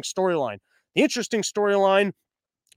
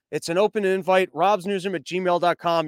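normal talking voice

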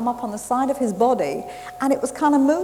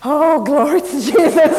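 loud, higher, slowed jubilant cry; joyful shout of praise; 6 semitones higher, 10 dB louder, slower than usual. Retold or imitated. Preacher echoing the healed man's praise, jubilant loud shout. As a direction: burst out in triumphant praise. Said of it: "And I went".

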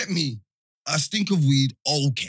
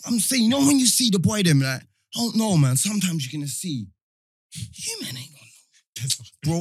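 ordinary delivery

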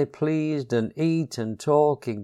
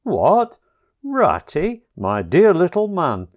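surprised and welcoming, higher and louder; suddenly friendly; 5 semitones higher, 6 dB louder than usual. A narrator's reading. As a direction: gruffness melting into delight. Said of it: "exclaimed the Badger in quite a different voice".

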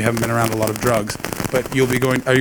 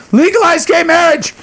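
high, louder indignant demand; indignant rant; 4 dB louder than usual. Acted. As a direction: shout the demand up high, outraged. Ranting at Australia.

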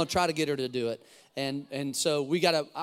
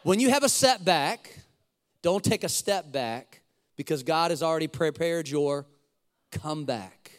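preached. Shown as a demonstration. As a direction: preach the saying out, phrase by phrase. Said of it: "you can preach that".

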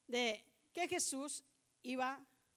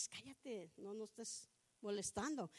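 soft, lower mock shushing; mild shushing; 4 semitones lower, 8 dB quieter than usual. Preacher imitates crowd hushing Bartimeo too mildly, soft shushing. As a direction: hush him gently, under the voice. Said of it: "Y no le decían así".

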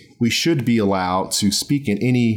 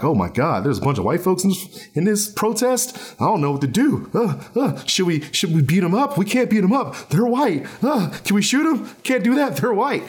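high, panicky mock cop voice, pitched way up; mock confused cops; 8 semitones higher than usual. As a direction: play a flustered cop who can't decide. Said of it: "They'll be like".

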